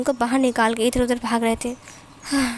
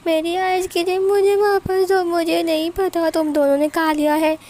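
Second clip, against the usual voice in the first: put-on high, loud child's whine; whiny little girl; 5 semitones higher, 4 dB louder than usual. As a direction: demand it back like a cranky toddler. Child demands ice cream back.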